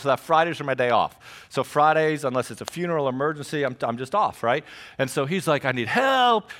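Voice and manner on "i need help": higher-pitched retold plea; mock plea for help. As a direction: cry for help, playfully overdone. Acts out friend's plea.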